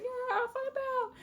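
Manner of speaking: high, drawn-out wail; hammed-up crying out for a lost friend; mock grief. Acted grief for Flame Pelt.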